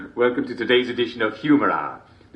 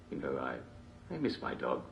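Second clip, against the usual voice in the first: sad aside; 10 semitones lower, 14 dB quieter than usual. Real, not acted. Sudden sad confession.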